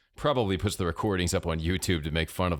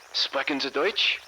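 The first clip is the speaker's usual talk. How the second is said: high, slow German radio voice; German accent; 8 semitones higher than usual. A narrator's reading. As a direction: ask slowly in an eager German accent. German radio man asks Samir.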